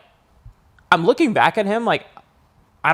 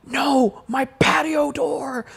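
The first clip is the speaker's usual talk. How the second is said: much higher, slow, frightened plea; scared insistence; 10 semitones higher, 3 dB quieter, much slower than usual. Retold or imitated. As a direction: stress that it's his door, scared. Scared correction to friends.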